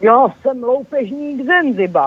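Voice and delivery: a lightly voiced mean robber; villainous robber. A narrator's reading. Robber introducing himself to the animals, lightly sinister. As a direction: announce yourself like a storybook villain. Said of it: "zasmál se ošklivě".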